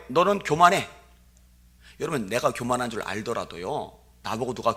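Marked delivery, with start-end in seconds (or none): 0.1-0.9 mock scolding. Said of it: "뭐라 그래요".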